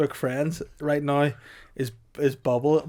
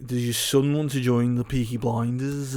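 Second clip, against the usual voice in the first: slow mock Birmingham gangster; Tommy Shelby. Gangster recruiting Pricey's baby, slow mock Brummie. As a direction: ask it in a drawling put-on Brummie accent. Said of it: "I will be Tommy Shelby".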